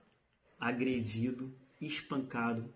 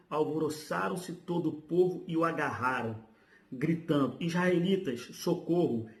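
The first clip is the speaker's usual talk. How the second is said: higher-pitched, projected reading aloud; emphatic reading with raised pitch; dramatic scripture reading; 4 semitones higher, 3 dB louder than usual. Acted. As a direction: declaim the Bible passage to the congregation. Reads scripture passage aloud.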